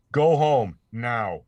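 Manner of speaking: slow, deliberate character read; slow acted line. Voice actor reading the directed line slowly.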